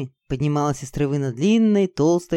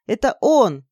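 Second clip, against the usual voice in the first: higher, triumphant exclamation; delighted; 6 semitones higher, 4 dB louder, slower than usual. Acted. Girl identifying the right rune, delighted. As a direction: announce the find with glee. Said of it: "обрадовалась я".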